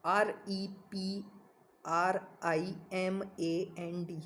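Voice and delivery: letters spelled out one by one, very slow and soft; slow spelling. Shown as a demonstration. Teacher spelling the word for students, slow and soft. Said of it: "spelling out the word".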